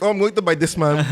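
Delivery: put-on Filipino accent. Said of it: "Filipino it up a little bit".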